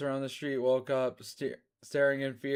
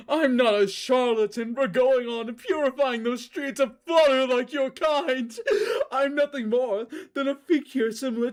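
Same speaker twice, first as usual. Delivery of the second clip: high, loud sneering villain voice; high, loud disdainful tirade; Moray's voice; 9 semitones higher, 6 dB louder than usual. Acted. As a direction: sneer it down at him like a pompous villain. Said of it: "read in Moray's voice".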